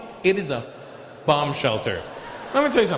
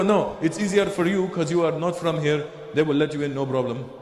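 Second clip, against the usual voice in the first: reassuring mock Lebanese English; Lebanese accent. Friends sending the visitor in alone, Lebanese accent.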